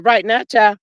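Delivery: ordinary talk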